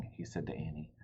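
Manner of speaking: usual speaking voice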